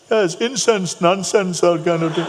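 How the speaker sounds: loud, high mock dismissal; crusty Jamaican man. Jamaican man dismisses the incense as nonsense, loud and crusty. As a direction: wave it away noisily in a high voice. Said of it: "very crusty voice".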